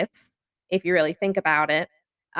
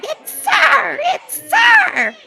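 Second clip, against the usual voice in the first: very high, loud mocking shriek breaking into laughter; mock scream with laughter; 8 dB louder, much slower than usual. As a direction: scream it while laughing at them. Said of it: "they're just screaming".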